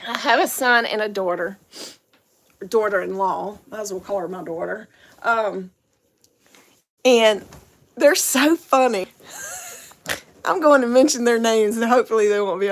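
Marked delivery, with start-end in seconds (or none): none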